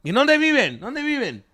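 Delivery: high, loud imitated demand; dad talking shit. Irritated dad asking the girls where they live, high loud demand. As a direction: mimic an irritated father grilling the passengers. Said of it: "just talking shit".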